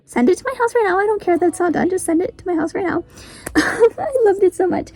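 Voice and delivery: high-pitched, rushed, excited plea; gushing demand. Retold or imitated. Gushing to the author about wanting the book, high excited demand.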